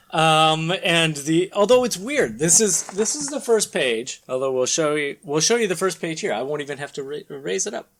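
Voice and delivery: ordinary delivery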